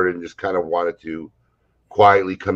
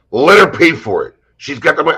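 a loud, higher barked order from the coach; gruff command; 9 semitones higher, 9 dB louder than usual. Retold or imitated. Coach grabbing the narrator's arm, loud higher barked order.

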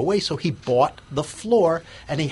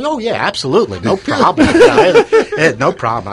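nervous laugh; 8 semitones higher, 11 dB louder than usual. Retold or imitated. Retelling panicked yes to big order.

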